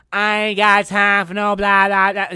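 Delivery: loud, high sassy mock voice; mock sassy girl. Mocking a rude girl he met, loud and high. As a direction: sneer it like a dismissive mean girl. Mocking mean girls' attitude.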